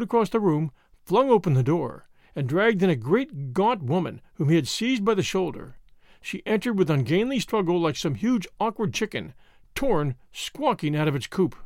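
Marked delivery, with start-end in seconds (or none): none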